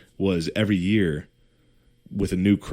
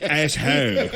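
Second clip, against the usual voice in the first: mock Irish accent; 7 semitones higher, slower than usual. Punchline in accent.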